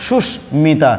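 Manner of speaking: সুস্মিতা spoken slowly with the স্ম cluster kept; careful slow demo. Shown as a demonstration. Teacher slowly models সুস্মিতা for the class. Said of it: "বলতে হবে".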